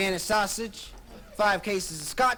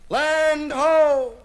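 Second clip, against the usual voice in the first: much higher, much louder cry from the mast; lookout yell; 8 semitones higher, 10 dB louder than usual. Acted. Lookout yelling to the crew, loud cry. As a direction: holler it down from the crow's nest. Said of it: "the guy in the mast yells".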